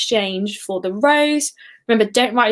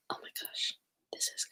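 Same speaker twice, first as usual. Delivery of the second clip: shocked gasp, then whispered dismay; 5 semitones higher, faster than usual. Real, not acted. Quizmaster gasping when nobody answers, then whispering. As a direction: gasp and drop to a hushed whisper.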